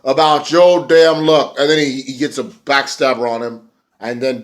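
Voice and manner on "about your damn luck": slow, louder mock taunt; imitating James Storm. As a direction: drawl the taunt like a cocky wrestler. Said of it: "James Storm said".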